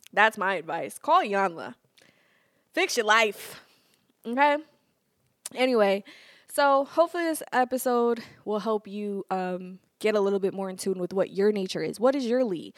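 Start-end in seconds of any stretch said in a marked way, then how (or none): none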